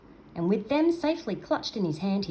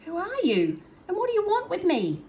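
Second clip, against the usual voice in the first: much higher, louder panicked little voice; frightened cry; 13 semitones higher, 5 dB louder than usual. Acted. Captured seal cries out to his captor in fright. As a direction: cry out in fear, pitched high. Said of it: "Trapper cried".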